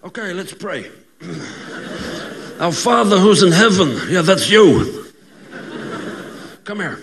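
mock Italian mobster leading mass; gangster pope. Gangster pope says mass and threatens, as a hammed-up mobster. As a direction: pray like you are shaking someone down. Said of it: "they become gangsters".

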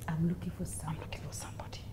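soft, dropping into a whisper; hushed searching words, barely voiced; imitating woman preacher. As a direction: imitate a preacher speaking very quietly. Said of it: "You know the way she speaks".

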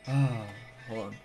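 normal talking voice

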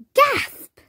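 high, loud gasped word; a mock-shocked gasp for the listener; dramatic gasp. A narrator's reading. Narrator voices a gasp.

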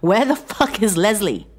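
demanding. A narrator's reading. Bound man demanding where Leslie is, angry. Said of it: "John demanded".

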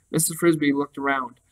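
plain reading voice

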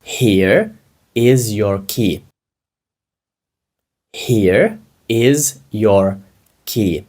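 very slow, drawn apart for learners; slow drill. Shown as a demonstration. Teacher drills the phrase very slowly for the class. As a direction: stretch each word so students can follow. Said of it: "Як ви скажете це англійською".

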